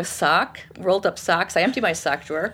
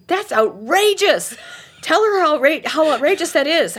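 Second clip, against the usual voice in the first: high, loud mock outrage to egg her on; 8 semitones higher, 4 dB louder than usual. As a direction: rile her up, louder and higher. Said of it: "I'm saying".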